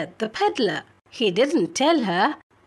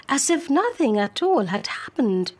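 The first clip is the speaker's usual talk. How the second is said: usual speaking voice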